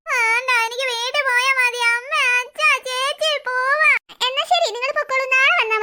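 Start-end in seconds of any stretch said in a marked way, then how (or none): 0.1-3.9 put-on slow, drawn-out child's wail; noisy crying tantrum. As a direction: bawl for family to take you home. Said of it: "നീ എന്തിനാ ഇങ്ങനെ ബഹളം വെക്കുന്നേ". (വലിച്ചുനീട്ടി ഉറക്കെ കരയുന്ന കുട്ടി)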